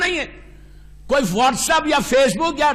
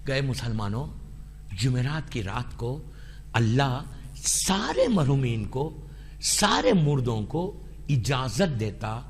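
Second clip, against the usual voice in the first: solemn hushed telling; 9 dB quieter than usual. Acted. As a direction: lower the voice to a solemn hush. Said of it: "تو اللہ کے رسول نے کہا".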